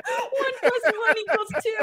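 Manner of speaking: laughing through the words; can barely talk for laughing. Real, not acted. Laughing hard at joke.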